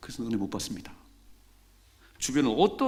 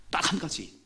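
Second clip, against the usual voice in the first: ordinary delivery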